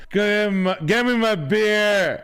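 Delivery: mock dad grumbling for beer, slowed; grumpy dad. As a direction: drag out a lazy dad's demand. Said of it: "your dad's saying".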